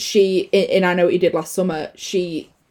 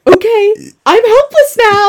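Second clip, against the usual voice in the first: higher, louder, slow and sarcastic; mock helpless voice; 12 semitones higher, 6 dB louder than usual. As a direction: play the helpless victim, hammed up. Mocking character voice.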